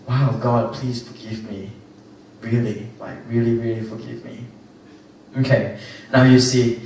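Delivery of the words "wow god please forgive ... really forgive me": a soft, pleading little prayer, voice dropping away; hushed mock prayer. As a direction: murmur it as if caught out before God. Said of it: "I'm like".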